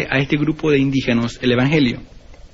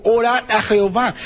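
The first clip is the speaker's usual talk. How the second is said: higher, urgent plea; Pharaoh pleading; 7 semitones higher than usual. Shown as a demonstration. Pharaoh begs Moses and Aaron, higher pleading. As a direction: beg them to pray, raised pitch. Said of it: "Entonces Faraón llamó a Moisés".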